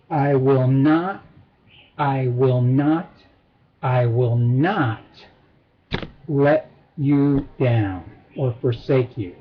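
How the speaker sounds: three slow, separate NOTs, low pitch; triple assurance. Shown as a demonstration. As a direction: stretch each NOT and pause after it. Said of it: "making this one a triple assurance".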